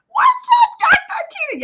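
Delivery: squealed high, loud hello to a friend; excited greeting. Real, not acted. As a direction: greet her like a long-lost sister. Greeting a viewer in live chat.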